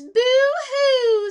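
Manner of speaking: hammed-up falsetto sob; rat crying. Teacher voicing the crying rat in the story. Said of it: "And then the rat goes".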